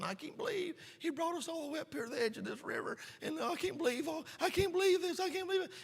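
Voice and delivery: moaning complainers at the river's edge; whining Israelites. Retold or imitated. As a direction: whine and moan like grumbling people. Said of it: "belly aching, crying and moaning".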